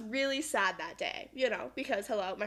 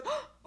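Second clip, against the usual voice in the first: imitated gasp; 14 semitones higher, 4 dB quieter than usual. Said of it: "And then she goes".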